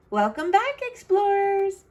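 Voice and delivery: high, cheery hint of a mother; mom's welcome. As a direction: greet the little explorers playfully. Mom greets snowy kids.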